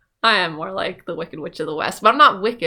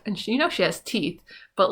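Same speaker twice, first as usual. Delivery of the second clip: ordinary delivery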